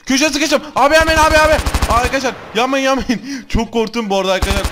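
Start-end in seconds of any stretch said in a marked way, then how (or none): 0.1-3.4 panicked gamer yelling at the screen; real panicked yelling. Real, not acted. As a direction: scream in panic as you get cornered. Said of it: "Kusura bakmayın bağırdığım için".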